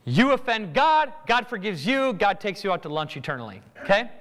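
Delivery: ordinary delivery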